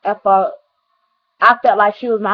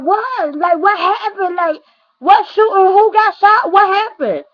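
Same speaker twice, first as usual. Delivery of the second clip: shocked disbelief; 8 semitones higher than usual. Retold or imitated. Friend calls with shooting news.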